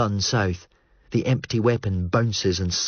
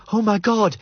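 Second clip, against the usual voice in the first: higher, frightened cry, almost a scream; near scream; 11 semitones higher than usual. Acted. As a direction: gasp it out in terror, just short of screaming. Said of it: "Jell almost screams".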